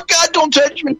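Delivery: shrill high mocking girlfriend voice. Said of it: "You know".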